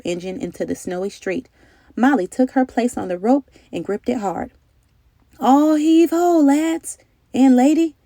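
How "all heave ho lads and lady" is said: high, loud, slow command; drawn-out heave call to the haulers; captain's order. A narrator's reading. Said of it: "the captain ordered".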